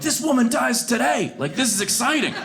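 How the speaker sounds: gleeful bloodlust. Retold or imitated. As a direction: savor the coming fight. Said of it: "we're like".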